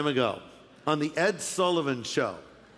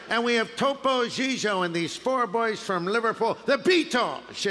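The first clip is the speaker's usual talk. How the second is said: lightly voiced showman announcing acts; a hint of a stiff TV host's intro; mild mock announcer; Ed Sullivan impression; 3 semitones higher than usual. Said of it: "Ed Sullivan sort of moved like this".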